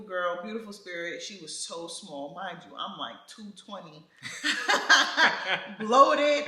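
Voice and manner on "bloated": laughing out the word; giggling through it. Real, not acted. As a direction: burst out laughing mid-sentence. Laughing while telling story.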